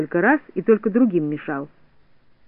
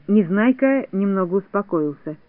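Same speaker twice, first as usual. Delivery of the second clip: ordinary delivery, nothing marked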